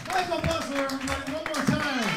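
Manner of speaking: loud, belted host hype; show announcer. Real, not acted. Host calling applause for the last comic, hype announcer. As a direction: project big over the cheering.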